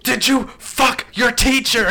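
high, loud, drawn-out shriek; hammed-up screech of parental shock; mock outraged mother. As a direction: imitate a scandalized mom yelling each word. Acting out shocked mom from video.

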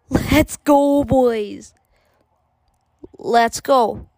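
frat bro. Real, not acted. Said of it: "cheering after goal".

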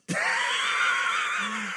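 high squealing laugh; shrieking laugh. Real, not acted. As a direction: let out a shrill burst of laughter. Bursts out laughing.

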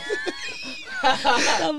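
high squealing burst of laughter; shrieking laugh. Real, not acted. Cracking up when his co-host fumbles the fist bump.

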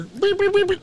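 very high, loud repeated beeps; piercing high electronic beeping; imitated alarm beeps. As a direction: mimic a hospital alarm going off.

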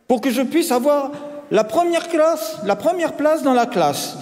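put-on high-pitched, cocksure boy; confident child voice, pitched right up; self-assured. A narrator's reading. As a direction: ask it boldly, as if entitled. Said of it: "dit-il avec assurance".

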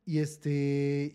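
ordinary talk

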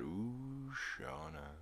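soft, fading echo of the name; hushed, trailing echo effect; echoing whisper. Retold or imitated. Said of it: "and then it does that".

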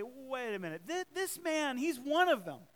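put-on the servant girl pointing Peter out; servant girl accusing. Servant girl exposes Peter before the crowd, accusing. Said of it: "began to say".

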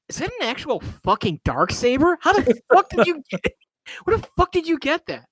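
high, incredulous yelling; can't believe what you're seeing; shocked disbelief. Acted. Shocked at the Darksaber.

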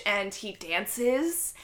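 usual speaking voice

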